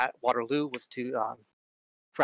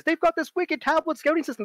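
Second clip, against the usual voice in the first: ordinary delivery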